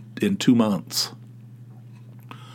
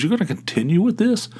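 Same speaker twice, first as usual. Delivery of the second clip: high mock disbelief; wife's incredulous high question; disbelieving wife; 9 semitones higher, faster than usual. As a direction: act stunned that he keeps going. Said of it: "She goes".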